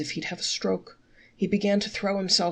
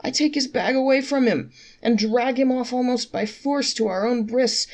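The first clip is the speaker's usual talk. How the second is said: higher, mock-offended protest; indignant; 6 semitones higher, 3 dB louder than usual. A narrator's reading. Innkeeper protests to traveler, mock offended. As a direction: act wounded that anyone thinks you'd charge.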